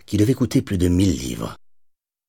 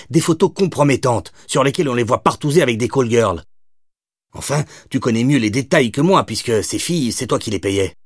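higher, pointed accusation from a lightly voiced cop; accusing cop; 8 semitones higher than usual. Cop to gangster exposing his secrets, pointed and higher. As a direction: lay out his secrets to his face. Madeleine confronts Doyle.

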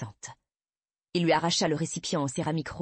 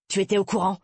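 père furieux qui hausse la voix; attaque accusatrice; 3 semitones higher, 6 dB louder, faster than usual. A narrator's reading. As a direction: accuser d'une question forte. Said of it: "Il s'en prit à ma mère". (éclat de colère, plus fort)

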